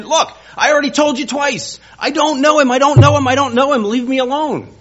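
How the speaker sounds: very high, loud, exasperated shouting; furious cursing denial. Retold or imitated. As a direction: yell it, fed up and cornered. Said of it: "he's cursing and swearing".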